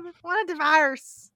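loud, high mock Irish-Southern twang; Irish-Southern accent. Host repeats the stepmom's divorce line, Irish-Southern accent. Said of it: "kinda like an Irish Southern".

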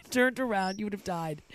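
ordinary delivery

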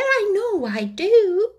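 put-on high, slow girlish Alice voice; a child talking to herself. Alice answering aloud, in a high childlike voice.

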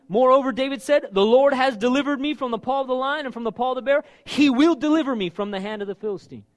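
loud, high bold reading; preaching at full force. Acted. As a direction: declare it with full conviction. Reads David's words with force.

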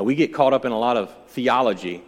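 normal talking voice

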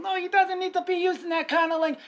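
high, quick objection; acted high-pitched insistence; retold indignant protest. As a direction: argue back in a high, rushed voice. Retold protest at the teacher aides.

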